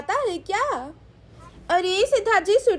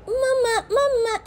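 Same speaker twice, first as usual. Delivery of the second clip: put-on high, whimpering toddler calling for mummy; baby girl voice; 6 semitones higher than usual. Little girl calls mummy from the bathroom, whiny baby voice. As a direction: babble like a tiny child with giggles and little cries.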